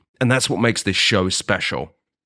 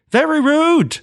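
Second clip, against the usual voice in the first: mock prissy voice; slower than usual. Second take in a put-on voice.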